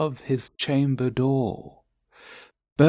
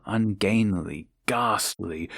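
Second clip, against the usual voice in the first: plain reading voice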